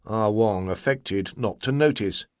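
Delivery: usual speaking voice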